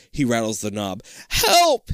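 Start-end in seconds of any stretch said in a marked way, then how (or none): 1.3-1.9 high, loud cry for help. Acted. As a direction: cry out in fear. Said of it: "he cries".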